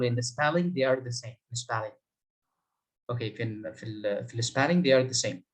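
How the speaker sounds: usual speaking voice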